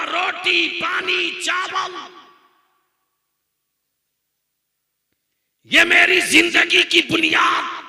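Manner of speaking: shouted high angry reply; angry retort. Retold or imitated. Maulvi shouting back at the preacher, angry. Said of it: "गुस्से में बोल पड़ा".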